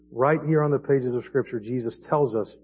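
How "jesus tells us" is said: ordinary delivery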